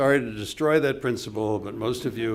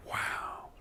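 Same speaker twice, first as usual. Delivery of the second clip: awed whisper; 10 dB quieter than usual. Retold or imitated. Awed reaction to Charlie's story.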